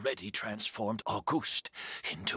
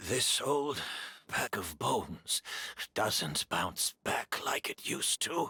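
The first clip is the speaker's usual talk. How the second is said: usual speaking voice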